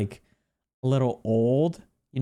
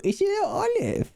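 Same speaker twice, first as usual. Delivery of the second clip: put-on much higher, squeaky baby talk; cute baby voice. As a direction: coo over the little olive like a baby. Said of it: "I don't know why I'm talking like that".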